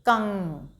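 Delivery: कंग spoken slowly with nasal ng; nasal syllable demo. Shown as a demonstration. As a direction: say कंग with the ं sounded through the nose. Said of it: "नाक से उच्चारण करेंगे".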